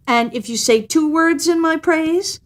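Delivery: cat pressing for a better bargain; sly cat. A narrator's reading.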